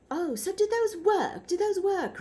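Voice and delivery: high mock-curious host voice; James Corden impression. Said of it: "And James Corden's like".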